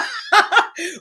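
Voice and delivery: high, breathy burst of laughter. Real, not acted. Laughing at a viewer's comment about her dad, in a high burst of laughter. Laughing between shouted lines.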